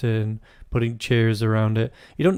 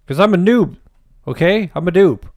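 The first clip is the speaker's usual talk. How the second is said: defensive; 6 semitones higher, 6 dB louder than usual. Real, not acted. Defensive outburst.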